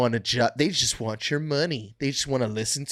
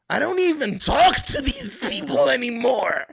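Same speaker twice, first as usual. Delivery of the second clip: much higher and louder, fed up; exasperated shout; 10 semitones higher, 3 dB louder than usual. Real, not acted. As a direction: blow up at the end. Rant peaks in a shout.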